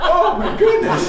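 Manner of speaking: slow, high, loud, laughing; startled father, slow and high, laughing; shocked relief. Retold or imitated. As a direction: gasp it out, half laughing. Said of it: "And I'm going".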